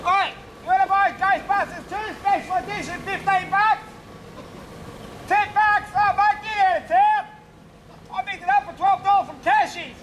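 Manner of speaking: high, pushy street hawker voice; high-pitched dodgy salesman getting huffy when refused; pushy DVD hawker. Acted. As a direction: hassle passers-by like a dodgy seller. Street seller hawking DVDs.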